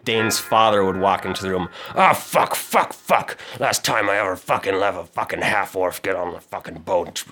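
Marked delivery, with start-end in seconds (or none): 1.9-7.2 angry cursing. Acted. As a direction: storm in cursing everyone. Drunk father storms in cursing.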